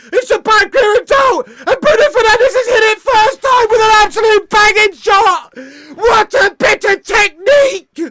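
high, loud, slowed yells of joy; real euphoric fan shouting; ecstatic shouting. Real, not acted. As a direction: bellow every word in amazement. Fan watch-along, goal replay.

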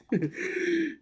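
short high-pitched giggle; high laugh. Real, not acted. Laughing at his own joke, squeaky giggle.

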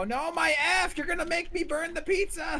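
high frantic yell; frantic high cry about the pizza; panicked shout. Real, not acted. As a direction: shout in alarm at the screen. Losing the F in game.

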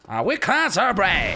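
high cowboy-style English; western accent. Pretend. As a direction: put on a country man accent. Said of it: "como un acento como del oeste".

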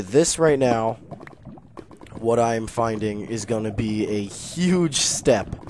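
normal talking voice

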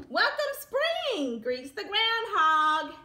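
slow bright announcement. Acted. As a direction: call it out slowly to welcome spring. Reading a picture book aloud.

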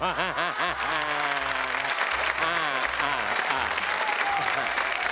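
long low hearty laugh. Real, not acted. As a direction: burst out laughing at your own joke. Laughs at own joke. (risa larga y grave)

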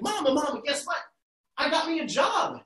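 high, eager calling to mama; excited boy. Acted. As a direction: burst in to tell mama. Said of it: "he's like".

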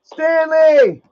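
loud, high shout; calling out. Real, not acted. Streamer breaking off to call Stanley, shouting. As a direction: holler the name sharply. Calling out over a played clip.

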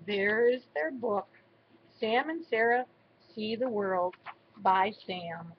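plain reading voice

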